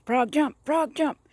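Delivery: high, loud commands in quick repetition; acted yelling. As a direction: keep yelling at the frog, pitch climbing.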